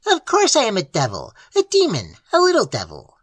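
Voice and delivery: high, leering imp voice; mocking little demon. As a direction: leer and gloat.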